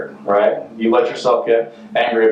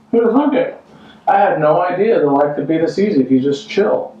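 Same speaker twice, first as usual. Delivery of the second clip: carefree idler act; 7 semitones higher than usual. Acted. Acting out lazing while work happens.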